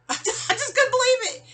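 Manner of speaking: a real burst of laughter at the absurdity; rueful laugh. Real, not acted. Laughing to the audience about being robbed, real laugh.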